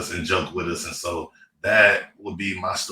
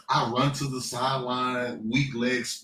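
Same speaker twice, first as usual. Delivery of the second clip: ordinary talk, nothing marked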